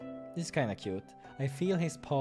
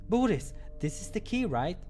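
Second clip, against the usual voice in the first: put-on much higher young voice for Krille; higher, lighter voice; 8 semitones higher, 4 dB louder than usual. Shown as a demonstration. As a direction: retake pitched far higher. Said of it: "No, wait, wrong".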